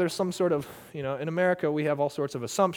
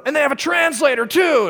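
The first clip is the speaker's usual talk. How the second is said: mock shout; 10 semitones higher, 6 dB louder than usual. Commander's report retold.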